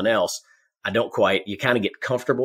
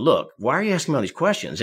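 mildly exasperated pushback, pitched up; frustrated retort; 5 semitones higher than usual. Retold or imitated. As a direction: imitate someone fed up with questions. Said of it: "guy starts to get frustrated".

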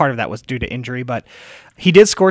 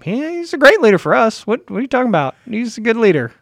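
high-pitched mock protest on a coach's behalf; Roberto Martinez; 9 semitones higher, 4 dB louder than usual. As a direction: act out a coach defending his player, pitched well up. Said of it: "was it Roberto Martinez?".

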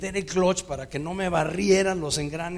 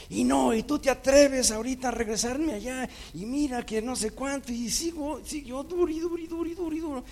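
escalating tirade; 10 semitones higher than usual. Retold or imitated. The girlfriend rants at him in the car, much higher and faster. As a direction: build to a shrill rant.

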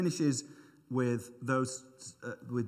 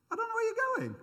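very high, puzzled squeak; confused Thomas; 4 dB louder than usual. Retold or imitated. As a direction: squeak it out like a baffled disciple. Thomas confused, quoted by preacher.

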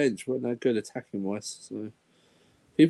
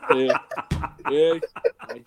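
usual speaking voice

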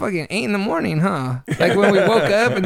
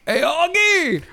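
high, drawn-out mock yell; hammed-up calling out, way up in pitch; imitated yelling; 11 semitones higher, 3 dB louder, much slower than usual. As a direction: holler it in a high voice, imitating a friend. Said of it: "you were yelling at me".

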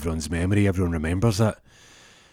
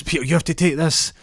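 urging Peter; 9 semitones higher, 4 dB louder than usual. Retold or imitated. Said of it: "He goes".

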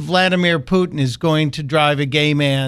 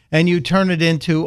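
normal talking voice

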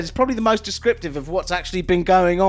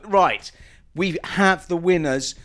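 usual speaking voice

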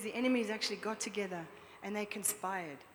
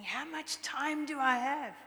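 higher, slowed, strained demon voice; Satan screaming; 5 semitones higher, 4 dB louder, slower than usual. Retold or imitated. Satan in the dream screams at the preacher, mock demonic yell. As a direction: rasp it out like a desperate devil. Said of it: "he just screamed at me".

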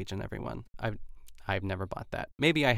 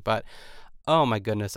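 normal talking voice

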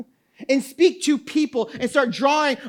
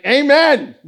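mock amen; 7 dB louder than usual. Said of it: "I was like".

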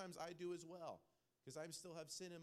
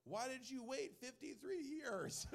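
put-on high, loud, drawn-out kid's protest; incredulous middle schooler; 9 semitones higher, 6 dB louder, slower than usual. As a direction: blurt it at Dad in mock outrage, stretching the words. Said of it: "What does the middle schooler ask".

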